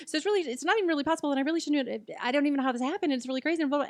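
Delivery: fast, high nervous babble; rodent on speed. Retold or imitated. Nervously blurting the news to her husband, fast and high. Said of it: "talking like a rodent on speed".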